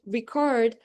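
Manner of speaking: reCORD with the weight on the last syllable; second-syllable stress. Shown as a demonstration. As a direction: stress "record" on CORD, as the verb. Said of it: "but saying record, uh, it turns into a verb".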